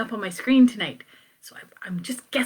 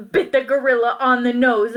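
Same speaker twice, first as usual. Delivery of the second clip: ordinary delivery, nothing marked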